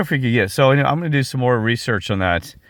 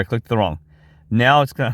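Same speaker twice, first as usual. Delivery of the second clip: ordinary delivery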